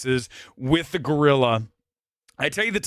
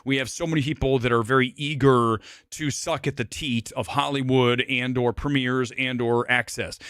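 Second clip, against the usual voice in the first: usual speaking voice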